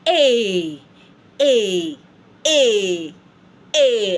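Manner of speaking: "A" repeated as a long ay; loud spaced "A" for learners to echo; each "A" held and falling; French vowel drill. Shown as a demonstration. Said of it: "Try to say it".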